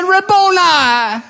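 high, slow, ringing cry of Rabboni; awed cry of recognition. Acted. Mary recognizes Jesus, falls down.

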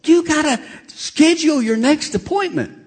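high-pitched, urgent insistence; high, excitable reminder; excited receptionist. Retold or imitated. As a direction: imitate an excited woman calling after a patient. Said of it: "she's all excited".

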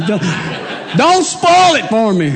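high, loud hammed-up begging; a touch of comic desperation; mock plea. Preacher jokingly begs the congregation not to spoil it.

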